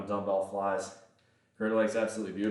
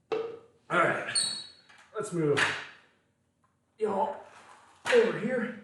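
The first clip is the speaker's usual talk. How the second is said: announcer voice; 8 semitones higher than usual. Real, not acted. Stream host announcer voice.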